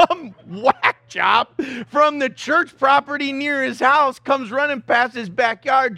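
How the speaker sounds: usual speaking voice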